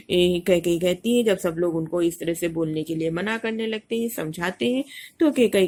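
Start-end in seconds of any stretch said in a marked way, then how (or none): none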